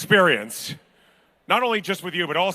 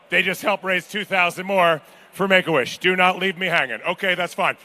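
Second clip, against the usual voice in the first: ordinary delivery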